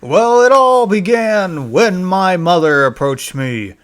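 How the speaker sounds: usual speaking voice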